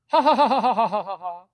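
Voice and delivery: loud, high machine-gun ha-ha, fading away; long staccato cackle sliding down in pitch; fake hearty laugh. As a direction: force a big phony salesman laugh. Manager's phony laugh, repeated.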